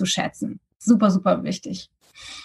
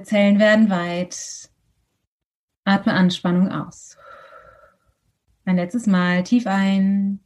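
guided meditation. Acted.

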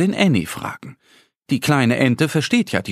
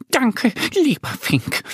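much higher, slow thankful squirrel; grateful squirrel call; 12 semitones higher than usual. A narrator's reading. Squirrel thanks the finch for finding nuts, high glad call. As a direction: call out your thanks happily. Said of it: "ruft es".

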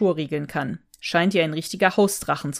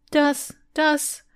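stammering shock; 9 semitones higher than usual. A narrator's reading. Klößchen speechless.

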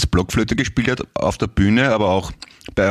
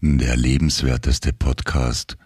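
tief und langsam wie eine Radio-Ansage; sonor, getragen, wie der Sprecher des Sendungs-Jingles; Jingle-Ansager; 10 semitones lower, slower than usual. Acted. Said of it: "Ich hör's so gerne, wenn du das sagst". (tiefe, langsame Ansagerstimme für den Podcast-Slogan)

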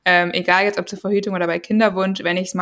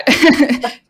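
embarrassed laugh; 6 semitones higher, 6 dB louder than usual. Real, not acted. Laughs at helicopter noise.

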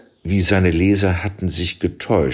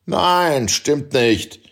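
angry shout back; 8 semitones higher, much slower than usual. A narrator's reading. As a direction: snap back in heated denial. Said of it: "schrie er wütend zurück".

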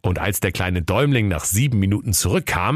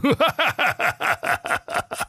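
mocking laugh. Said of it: "lachten nur".

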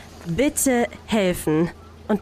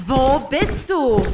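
furious; 4 semitones higher, slower than usual. Acted. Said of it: "vor Wut schäumend".

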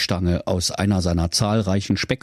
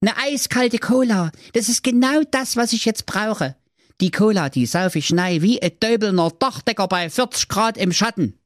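put-on high greedy boyish voice; eager Brudi; 11 semitones higher than usual. Brudi eyeing the cola, high eager voice. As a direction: lick your lips over the cola.

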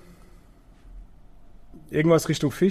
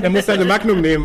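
laughing; 6 semitones higher, 7 dB louder than usual. Real, not acted. Joking about an empty bottle.

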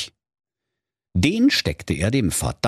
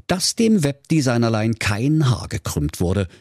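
ordinary delivery, nothing marked

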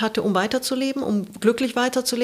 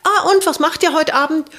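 higher, louder light fishing question; casual hopeful question; 6 semitones higher, 6 dB louder than usual. Retold or imitated. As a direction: ask casually, hiding the need. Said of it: "rief dann an und meinte". (hohe, laute beiläufige Frage)

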